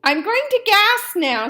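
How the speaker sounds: high, loud announcement; announcing. A narrator's reading. Dentist announcing the gas to the fox. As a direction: declare it plainly.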